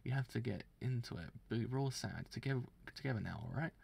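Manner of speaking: ordinary delivery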